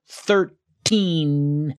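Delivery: put-on "thirteen" split, with a long, heavy "teen"; exaggerated second syllable. Shown as a demonstration. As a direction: break "thirteen" in two and lean hard on "teen". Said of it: "putting an unnecessary emphasis on the second syllable".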